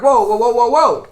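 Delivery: loud, rapid string of whoas; alarmed bus driver. Retold or imitated. Driver breaking up a row on his bus, loud and alarmed. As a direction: cut in loudly to halt a row. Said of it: "bus driver's like".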